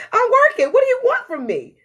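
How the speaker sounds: high, loud and laughing through it; defensive brain. Retold or imitated. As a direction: protest like a hounded worker. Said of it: "my brain is like".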